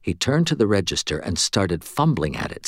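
ordinary delivery, nothing marked

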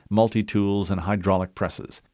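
ordinary delivery, nothing marked

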